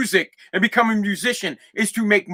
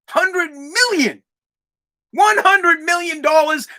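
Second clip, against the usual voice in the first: high, slow, stretched-out disbelief; incredulous emphasis; 6 semitones higher, slower than usual. Real, not acted. Podcaster marvelling at the money, high incredulous emphasis. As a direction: lift the pitch and stretch each word.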